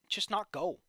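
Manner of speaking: normal talking voice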